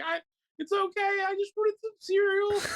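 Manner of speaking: very high, squeaky tearful imitation; mock crying. Pregnant wife in the cereal aisle, mock crying. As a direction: whimper it like a weepy wife. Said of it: "just starts crying".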